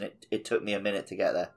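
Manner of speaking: ordinary talk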